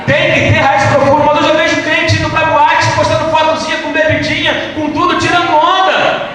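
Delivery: loud, high-pitched preaching at full force; pregação inflamada. Real, not acted. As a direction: shout it to the congregation with rising fervour. (pregação aos brados, voz alta e aguda)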